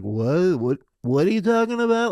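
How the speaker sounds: lightly voiced dismissive manager; mock clueless manager. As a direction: play the uncaring manager.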